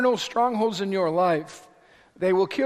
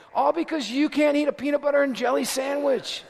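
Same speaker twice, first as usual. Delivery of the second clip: ordinary delivery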